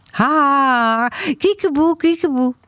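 put-on high, playful toddler voice; gleeful peekaboo. A narrator's reading. Toddler found in the closet, high gleeful peekaboo.

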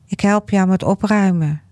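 ordinary delivery

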